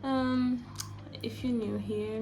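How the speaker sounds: ordinary talk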